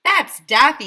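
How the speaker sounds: loud, high outraged yell; teacher yelling in outrage. A narrator's reading. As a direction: shout the protest. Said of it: "yelled Missus Jaffe".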